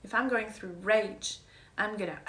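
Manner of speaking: ordinary talk, nothing marked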